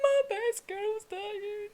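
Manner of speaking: high, slow mock-tragic wail; hammed-up heartbroken fan moaning to a friend. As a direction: ham up a grieving fan.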